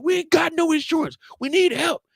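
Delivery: high, loud desperate plea; pleading uncle. Retold or imitated. Uncle begging the front desk for help, high pleading. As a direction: beg the nurse, voice pushed up high.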